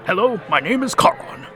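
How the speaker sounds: gruff ferryman. Acted. Ferryman reads his name tag.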